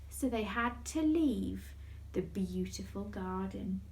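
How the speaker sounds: ordinary delivery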